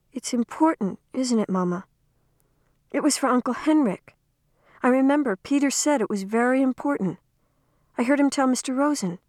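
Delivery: a hint of a curious young girl explaining; young girl Anna Marie. A narrator's reading. Anna Marie voiced as child.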